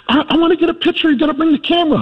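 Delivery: high, fast gushing lady voice; excited older lady. Retold or imitated. Older lady urging husband to bring camera, excited. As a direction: rush it like a thrilled fan. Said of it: "And she goes".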